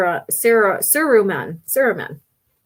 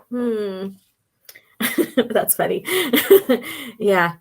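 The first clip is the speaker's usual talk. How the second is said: ordinary talk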